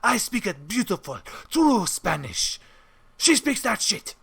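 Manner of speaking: haughty mock Spanish accent, slowing on the boast; imitated snobbish Spaniard's accent; David. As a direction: proclaim it in a put-on Spanish accent. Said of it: "looks me in the eye and goes".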